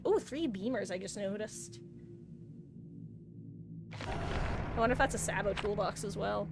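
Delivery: ordinary talk, nothing marked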